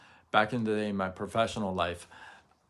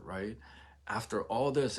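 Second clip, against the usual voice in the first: usual speaking voice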